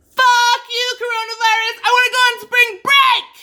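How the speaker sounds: high, full-throated yell; primal scream. Shown as a demonstration. Mom venting about cancelled spring break, screaming. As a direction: scream it at the top of your lungs. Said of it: "the primal scream that we did".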